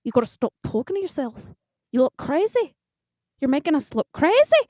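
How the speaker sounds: scolding yourself in fake Irish; clumsy Irish telling-off, rising in pitch; hammed-up Irish self-reproach; bad Irish accent. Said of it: "I talked to myself".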